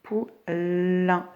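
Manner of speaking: Poulain as pou... llllain; drawn-out final-syllable L. Shown as a demonstration. Teacher showing L at the end, Poulain with held L. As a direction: stretch the L in the second half of "Poulain". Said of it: "c'était à la fin".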